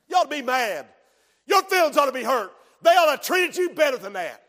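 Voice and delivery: loud, high, indignant goading; stirring up hurt feelings, loudly; devil goading. Acted.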